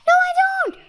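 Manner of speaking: very high squeaky shriek; high-pitched squealed refusal. A narrator's reading. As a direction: shriek in protest like a small child. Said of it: "shrieked the voice".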